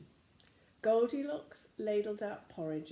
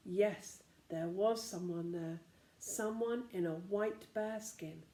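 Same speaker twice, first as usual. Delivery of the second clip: ordinary delivery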